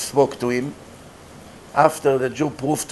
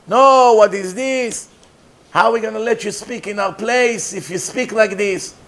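a scandalized leader demanding an explanation; indignant outrage; 7 semitones higher than usual. Retold or imitated. Community leaders rebuke the rabbi, indignant and raised.

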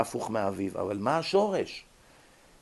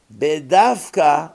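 בדווקא stretched slowly and said much louder; להדגיש בכוח את המילה בדווקא, לאט; emphatic stress; 12 dB louder, much slower than usual. Acted. Emphatic key word in lecture. (הדגשה חזקה ואיטית על בדווקא)

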